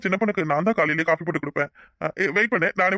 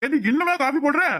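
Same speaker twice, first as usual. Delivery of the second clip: impatient call; 8 semitones higher than usual. Acted. Husband shouts at slow wife.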